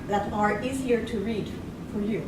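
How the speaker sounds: normal talking voice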